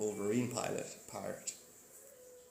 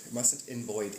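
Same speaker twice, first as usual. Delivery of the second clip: usual speaking voice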